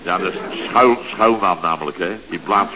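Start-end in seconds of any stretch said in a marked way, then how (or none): none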